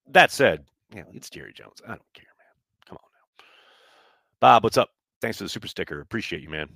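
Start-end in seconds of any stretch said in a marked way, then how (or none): 0.9-3.4 dropping to a near whisper, dismissive; hushed aside. Real, not acted. Dismissing Jerry Jones to the chat in a hushed whisper. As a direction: mutter it off under your breath.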